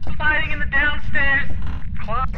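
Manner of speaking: loud, high, slow reply; loud laughing answer. Real, not acted. Friend explains where they hid, loud high reply. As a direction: call it out loudly.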